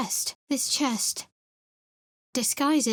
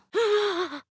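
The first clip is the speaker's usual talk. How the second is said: sharp gasp; 11 semitones higher than usual. Acted. Sudden shock in battle.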